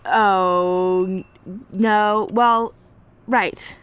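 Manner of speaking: flustered. Real, not acted. Caught out by interviewee.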